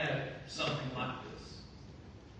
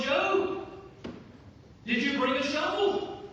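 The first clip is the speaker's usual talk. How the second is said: higher, louder puzzled soldier asking a mate; mock soldier question; 11 semitones higher, 7 dB louder than usual. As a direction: call across to Joe, half-joking. Soldiers asking each other, acted.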